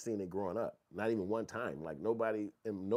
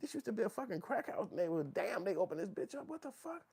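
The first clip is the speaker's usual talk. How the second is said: much higher incredulous voice; much higher stunned voice; amazed disbelief. Retold or imitated. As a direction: gawk at the gentrified block.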